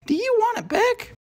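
very high, squeaky little mouse. A narrator's reading. Mouse offering the crocodile his tooth, squeaky high. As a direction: ask it in a tiny mouse squeak. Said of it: "turning around to face the now smiling crocodile".

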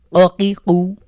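অকিকু with a hard push on the ক sound; emphatic qaf. Shown as a demonstration. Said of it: "কফের উচ্চারণে ধাক্কা দিয়ে পড়তে হবে". (অকিকু, কফে ধাক্কা দিয়ে)

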